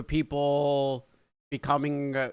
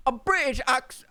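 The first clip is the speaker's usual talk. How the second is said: higher-pitched attempt at a British accent; terrible British accent; 8 semitones higher than usual. Shown as a demonstration. American who says he can't do it, shaky British accent attempt. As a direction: try a bad British accent, then give up. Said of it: "My English accent is terrible".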